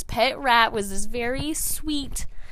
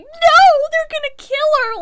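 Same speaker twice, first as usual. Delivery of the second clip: high, loud mock-panicked wail; hammed-up shriek of alarm; freaking out; 14 dB louder than usual. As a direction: imitate a frantic kid yelling in protest. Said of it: "he was freaking out".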